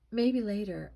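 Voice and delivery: loud, mildly moaned put-off; a touch of a moan. A narrator's reading. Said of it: "I moaned".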